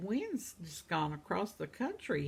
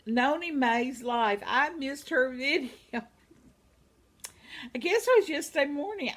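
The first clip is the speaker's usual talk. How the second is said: excited; 7 semitones higher, 6 dB louder than usual. Real, not acted. Excited about a live video.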